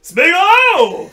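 high, loud desperate call; desperate cry. Acted. Frodo calling Smeagol, loud and desperate. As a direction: cry out in despair. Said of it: "said Frodo desperately".

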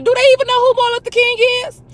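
high, loud, fast indignant question. Retold or imitated. Asking coworkers if they know who he is, indignant. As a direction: demand to know, outraged.

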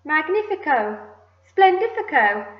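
high, loud, theatrical cries; silly excitement. A narrator's reading. Aunts hopping round the tree, excited shouting. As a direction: exclaim each word with a flourish. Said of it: "silly things in their excitement".

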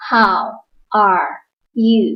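each word said apart, slowly; slow separate words. Shown as a demonstration. Teacher reading words slowly one at a time to beginners. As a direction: space out How, are, you one by one. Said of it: "เมื่อเราพูดเร็วๆ เราจะเชื่อมเสียง".

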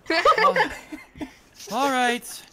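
high, loud put-on woman's voice with a laugh; finally landing the orc woman's voice; orcish woman's voice found. GM to the players, in the orcish woman's high voice. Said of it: "Search within yourself".

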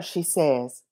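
plain reading voice

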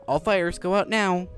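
ordinary delivery

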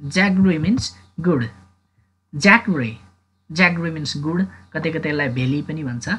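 usual speaking voice

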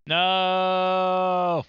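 a loud, high cry of defeat; frustrated shout. Real, not acted. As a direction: yell it as the game is lost. Loses the game at last moment.

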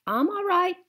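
put-on high, loud old woman; old woman's voice. A narrator's reading. Gloria tells the narrator she is fine, high voice. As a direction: brave it out.

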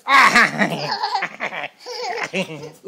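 big real laugh; hearty laugh. Real, not acted. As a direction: laugh out loud. Laughing at baby.

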